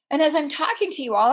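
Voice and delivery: normal talking voice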